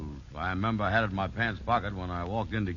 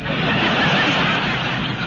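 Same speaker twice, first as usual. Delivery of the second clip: laughter; 8 dB louder than usual. Real, not acted. Audience laughter burst.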